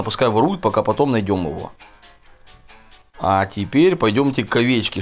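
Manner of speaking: ordinary talk, nothing marked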